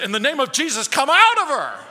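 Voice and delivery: loud, high command building to a yell; hammed-up deliverance preacher casting out a demon; mock exorcism shout. Preacher playing the exorcist he wishes he was, mock shout.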